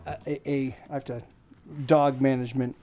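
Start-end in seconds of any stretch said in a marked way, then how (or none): none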